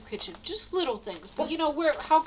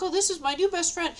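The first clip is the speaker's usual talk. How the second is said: cheerful introduction; 8 semitones higher, 3 dB louder than usual. Retold or imitated. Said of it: "she's real happy".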